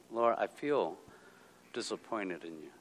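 quiet, downcast model prayer; hushed confession to God; soft honest confession. Shown as a demonstration. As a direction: say it softly, like admitting it. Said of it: "get that off your chest".

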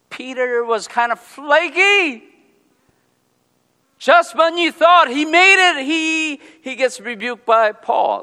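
loud, high, fervent preaching. Acted. Pastor preaching to the congregation, loud and fervent. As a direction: preach it out with full force.

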